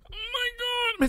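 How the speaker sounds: exasperated squeal. Retold or imitated. Exasperated cry.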